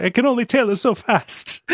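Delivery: high put-on character voice; quoting a character. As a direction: pitch it up for the character. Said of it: "And he's like".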